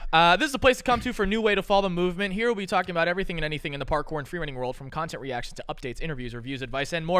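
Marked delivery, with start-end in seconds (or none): none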